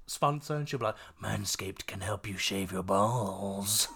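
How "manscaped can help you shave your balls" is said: slow, lightly spooky ad voice; scary voice. Retold or imitated. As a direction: read it a little creepy and slow. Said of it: "scary voice for every advert".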